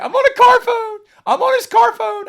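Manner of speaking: put-on shrill cracking kid voice shouting the news; thrilled kid squeal. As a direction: squeak it out like a kid bursting with pride. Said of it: "my voice even cracked as it went higher".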